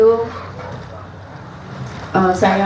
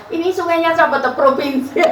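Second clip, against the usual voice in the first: high laughing retold question; laughing quote; 7 semitones higher than usual. Retelling her talk to Mbak Ganis, laughing.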